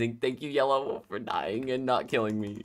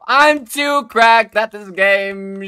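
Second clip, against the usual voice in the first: slow, higher and louder bragging; loud boast; 11 semitones higher, 12 dB louder, much slower than usual. Real, not acted. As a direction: stretch it out like a triumphant brag. Boasting after a good play.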